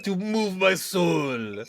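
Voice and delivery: put-on slow, dreamy French accent with a laugh; a French snob swooning over art. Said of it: "I put a French accent".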